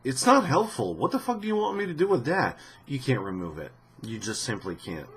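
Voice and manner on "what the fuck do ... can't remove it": ordinary talk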